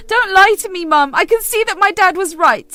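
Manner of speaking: put-on hurt teenage daughter lashing out; angry accusation. Daughter accuses her mother of abandoning her, furious.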